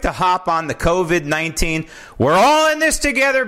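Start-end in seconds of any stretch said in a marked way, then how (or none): 2.2-3.5 sing-song high mock of the slogan, louder; mocking corporate slogan. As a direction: parrot the ad line with scorn. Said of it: "these messages from these corporations".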